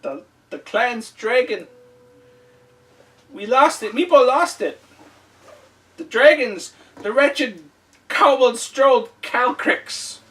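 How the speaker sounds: high, loud panicked kobold; frightened kobold voice. Acted. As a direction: whimper out the loss like a scared little kobold.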